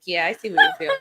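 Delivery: high, loud mock-knowing reply; knowing sarcastic retort. Teen daughter teasing her mom, sarcastic and high.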